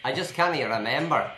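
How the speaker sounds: broad Scottish accent, pushed loud. Real, not acted. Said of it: "What was that accent?".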